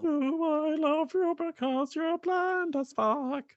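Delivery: very high, slow mock swoon; mock dreamy. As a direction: swoon sarcastically. Mock romantic line parody.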